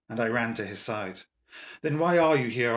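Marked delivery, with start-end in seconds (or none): 1.8-2.7 raised, louder urgent question; higher, insistent demand. A narrator's reading. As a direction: press urgently for an answer. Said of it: "I asked urgently".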